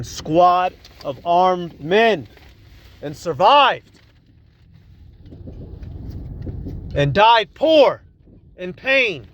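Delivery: rising to a loud, high cry of outrage; outraged outburst. Real, not acted. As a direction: shout it out in disbelief. Outraged at hero's poor death.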